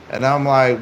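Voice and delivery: usual speaking voice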